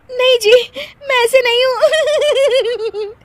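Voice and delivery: high, loud protest giving way to giggles; pleading through laughter. Acted. Wife defending herself to husband, laughing. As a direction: plead while helplessly laughing. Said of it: "क्यों हंस रही थी तुम?".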